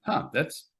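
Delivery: slow, drawn-out huh of mild puzzlement; a lightly voiced double take; a touch of bemused disbelief; puzzled inner thought. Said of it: "where I'm like".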